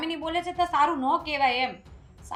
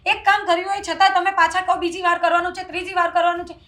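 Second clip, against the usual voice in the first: higher and louder, finally snapping back; exasperated outburst; 4 semitones higher, 8 dB louder than usual. Acted. Daughter-in-law finally yells at her mother-in-law in exasperation. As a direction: let it burst out after holding back all along.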